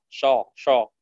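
شا with a thick, backed vowel; heavy shin. Shown as a demonstration. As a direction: darken the vowel of شا, heavy and full. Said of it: "أحيانًا تفخم".

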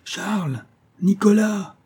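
high, long-distance hail of names; calling out. A narrator's reading. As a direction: call for missing people across the water. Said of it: "crient les voix en s'approchant".

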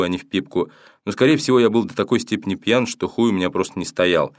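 ordinary delivery, nothing marked

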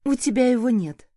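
bitter, mocking jab; a sour, mocking retort; bitter smirk. A narrator's reading. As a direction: throw it at him with a bitter little laugh. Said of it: "с горькой усмешкой добавила".

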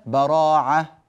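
"براعة" with a firm throaty ain; hamza as ain. Shown as a demonstration. Teacher showing students the ain in "براعة". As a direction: say "براعة" pressing the ain hard. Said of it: "مثل".

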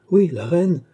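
loud, high, impatient insistence; emphatic confirmation. Acted. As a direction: hammer home the obvious answer. Doctor insists impatiently on his answer.

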